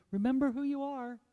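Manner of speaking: a mom's firm parting reminder at the door; mother's reminder. Retold or imitated. Mother calls to child leaving to play, firm reminder. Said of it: "and my mother would say".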